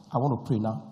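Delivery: usual speaking voice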